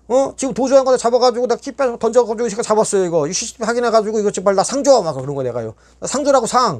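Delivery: 높은 목소리로 몰아붙이는 항의; 억울한 듯 계속 들이밀기; 상 달라 떼쓰기. Retold or imitated. 상 달라고 교도관에게 떼씀. (교도관에게 상 달라고 높이 떼쓰는 말투)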